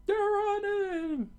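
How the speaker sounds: slow, loud, very high falsetto cry; yelled. A narrator's reading. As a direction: raise the alarm in a drawn-out squeal. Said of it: "the townspeople yelled out".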